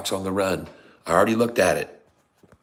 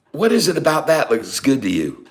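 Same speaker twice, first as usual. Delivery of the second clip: louder, high-pitched incredulous question; exasperated disbelief; 6 semitones higher, 6 dB louder than usual. Real, not acted. Streamer challenging viewer's pick of chart, loudly incredulous.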